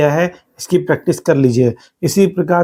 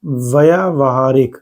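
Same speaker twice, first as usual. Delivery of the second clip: व्यावहारिक said slowly, every syllable clear; teacher gives the correct form; slow careful pronunciation; 2 semitones lower, slower than usual. Shown as a demonstration. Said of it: "क्या होता है?".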